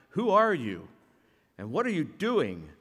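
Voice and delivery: loud, high angry shout. Acted. Josh yelling at the boy who threw the brick, loud and angry. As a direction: yell it at the boy in fury. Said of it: "and he shouted".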